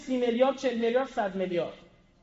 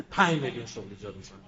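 lower, fast parody of a bigger promise; mocking a rival; 13 semitones lower, 6 dB quieter, faster than usual. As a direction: rattle off the inflated promise mockingly. Rival candidate outbids. (تقلید تند و تمسخرآمیز با صدای بم)

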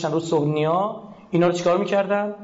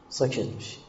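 hushed; 6 semitones lower, 8 dB quieter than usual. Acted. Example of staying silent.

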